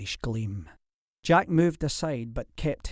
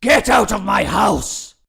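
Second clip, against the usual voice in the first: much louder, higher order to leave; furious shout; 8 semitones higher, 12 dB louder than usual. A narrator's reading. Lord ordering his enemy out, furious shout. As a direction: throw him out with your voice.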